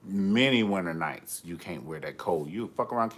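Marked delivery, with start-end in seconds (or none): none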